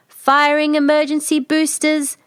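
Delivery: steady machine report; ship computer. Acted. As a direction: announce it like the ship's computer.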